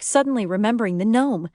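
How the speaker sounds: plain reading voice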